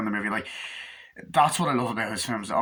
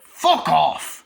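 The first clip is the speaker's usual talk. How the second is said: drawn-out, louder, higher outburst; old YouTube-rant outburst; 5 semitones higher, 8 dB louder than usual. Pretend. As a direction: let it rip like an over-the-top YouTuber. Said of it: "my old YouTube version's coming out".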